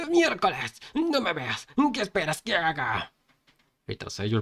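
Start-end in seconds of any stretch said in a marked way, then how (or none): 0.1-3.1 high, strained yelling; panicked character's high shout. A narrator's reading. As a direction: yell back in high-pitched protest. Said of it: "el gordito gritó".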